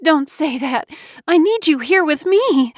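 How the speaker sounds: desperate woman begging her dying friend; crying plea. Acted. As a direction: beg him through tears. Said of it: "Jo Beth cried".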